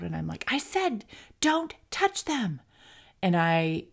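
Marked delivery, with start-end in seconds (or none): 0.5-2.6 high-pitched scolding yell, volume held back; retold yell. Mother re-enacts yelling at her five-year-old over macaroons, high mock bellow. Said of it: "I yelled so loud".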